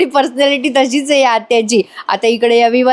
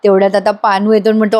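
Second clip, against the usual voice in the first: ordinary talk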